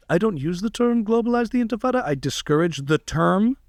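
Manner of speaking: lightly imitated careful politician phrasing; a hint of a guarded, precise speaker; Zohran Mamdani impression. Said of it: "he's very particular on how he phrases things".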